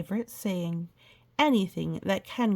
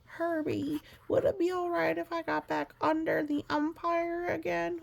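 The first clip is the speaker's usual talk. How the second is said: high, small pleading Grover voice; 9 semitones higher than usual. Acted. Grover asks Herbie to share the umbrella, high and pleading. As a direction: ask sheepishly in a high furry-monster voice.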